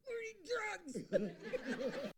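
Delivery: high, very drawn-out strained plea; desperate grab. Retold or imitated. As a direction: strain it out slowly, begging through labor pain. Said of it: "I grab him by the shirt".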